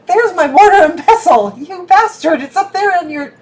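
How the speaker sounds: high, very loud cry of discovery; indignant outburst. Real, not acted. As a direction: exclaim loudly in playful outrage. Sudden outburst on spotting missing pestle.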